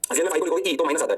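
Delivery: normal talking voice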